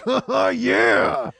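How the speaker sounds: long, drawn-out whoop; triumphant cheer. Retold or imitated. Storyteller celebrates the star's approval, triumphant whoop. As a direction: let out a victorious yell.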